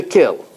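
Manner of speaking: "kill" for kiln, final N dropped; alternate silent-N form. Shown as a demonstration. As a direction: stop "kill" on the L, with no nasal after it. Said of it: "an alternate pronunciation of kill".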